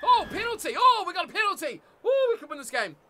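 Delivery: high, loud burst of excitement; excited. Real, not acted. Gamer reacting to winning a penalty, excited. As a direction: cry out at a sudden big chance. Penalty won during rugby game.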